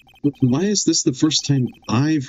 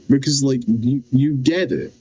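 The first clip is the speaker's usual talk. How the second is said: ordinary delivery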